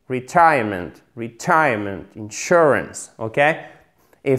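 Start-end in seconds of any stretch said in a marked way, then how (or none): 0.1-2.0 "Retirement" with weight on TIRE, falling off at the end; model stress. Shown as a demonstration. Teacher drilling learners, second-syllable stress. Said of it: "Accent tonique sur la deuxième syllabe". 2.2-3.1 "insurance" stressed in-SUR-ance; model stress. Shown as a demonstration. Teacher's comparison drill, second-syllable stress. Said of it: "comme dans insurance".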